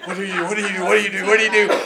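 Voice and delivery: rattled, repeated self-questioning; mock panic. Retold panicked thought.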